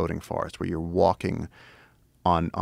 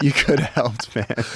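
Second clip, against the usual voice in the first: higher and louder, laughing through it; laughing jab; 5 semitones higher, 6 dB louder, slower than usual. Retold or imitated. Ribbing his frozen friend, laughing. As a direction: tease him through a laugh.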